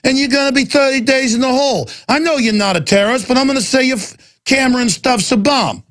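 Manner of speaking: high put-on mobster voice; security guard threatening the reporter in a mock mobster voice; mock Godfather gangster. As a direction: ham up a menacing movie gangster. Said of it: "literally like The Godfather".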